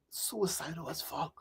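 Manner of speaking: quiet slow repetition of a dark memory; hushed. Real, not acted. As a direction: let it drop to almost nothing, heavy. Recalls teenage suicidal years.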